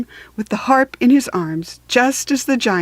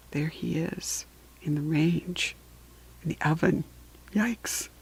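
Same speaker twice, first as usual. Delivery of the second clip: hushed aside; 4 semitones lower, 12 dB quieter than usual. Real, not acted. Aside to child over picture book.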